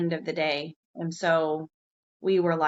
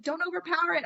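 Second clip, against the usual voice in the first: high, retold anxious urging; freaking out; 9 semitones higher, 3 dB quieter, faster than usual. Warning a teammate fighting a fish, freaking out. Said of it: "I'm, like, freaking out".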